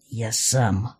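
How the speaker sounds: low, subdued, grim male role; grim promise. A narrator's reading. Man promises to deal with the villainess himself, grim. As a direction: drop the pitch and promise it grimly. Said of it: "мрачно пообещал мужчина".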